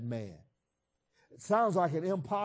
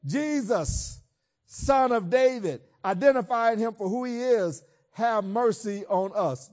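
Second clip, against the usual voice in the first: raised, slowed plea to Jesus; crying out for mercy; 6 semitones higher, 6 dB louder than usual. Acted. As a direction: call out loud and slow, begging. Blind men crying out.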